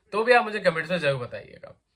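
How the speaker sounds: usual speaking voice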